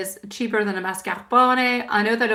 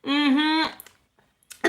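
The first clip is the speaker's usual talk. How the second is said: ordinary talk, nothing marked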